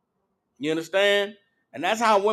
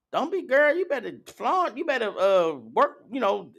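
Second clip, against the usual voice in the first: higher-pitched mock of a sassy hype friend; mock the "go girl" cheerleader voice, pitched up; 4 semitones higher than usual. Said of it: "I can't even do the damn impression".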